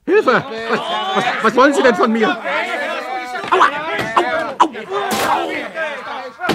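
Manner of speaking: shrill panicked pleading, then pained yelps; panicked cry for help. Acted. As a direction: scream like you're being grabbed. Man attacked, cries for help.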